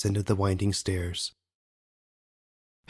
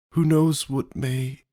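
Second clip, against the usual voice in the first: slow, higher, left hanging unfinished; trailing off; 5 semitones higher, slower than usual. A narrator's reading. Father confiding in mother, slowly trailing off. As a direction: let the thought hang, drawn out. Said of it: "His voice trailed off".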